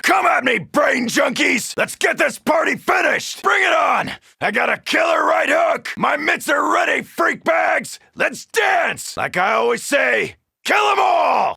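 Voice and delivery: cocky fight-ready scream; taunting shout. Acted. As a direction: bellow at the horde, itching to brawl. Taunting zombies in melee.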